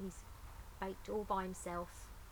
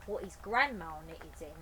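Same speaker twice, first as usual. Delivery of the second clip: plain reading voice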